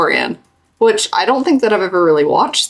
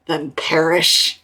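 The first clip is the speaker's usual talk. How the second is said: mock cold dismissal; 2 semitones lower, 3 dB quieter, slower than usual. Playing Obama coldly dismissing the drowning woman, slow and dry.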